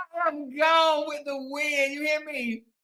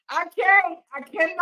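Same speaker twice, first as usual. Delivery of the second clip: normal talking voice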